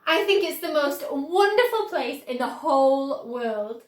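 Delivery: Charlie voice. A narrator's reading. Charlie telling Wonka he loves the place, excited. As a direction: gush with wonder.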